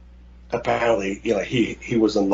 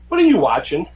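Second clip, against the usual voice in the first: higher, louder imitation of a mother; mock disapproving mom; 7 semitones higher, 6 dB louder than usual. Mom walks in at the worst moment, mock shock. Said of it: "your mom walks in and goes".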